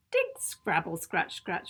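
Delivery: ordinary delivery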